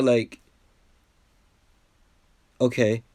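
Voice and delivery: ordinary talk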